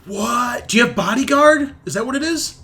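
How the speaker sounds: high-pitched surprise; real, high astonished questions; astonished questioning. Real, not acted. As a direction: blurt it out in rising disbelief. Surprised by the player's rescue talent.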